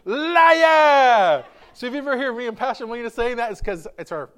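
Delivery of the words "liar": high, drawn-out mock screech; very loud comic accusation, falling at the end; movie wife's yell. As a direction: shriek it as an outraged wife, hammed up. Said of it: "where she says".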